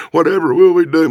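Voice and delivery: hammed-up helpless fretting; upset damsel. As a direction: wail it like a melodramatic heroine. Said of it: "Michelle Obama's upset".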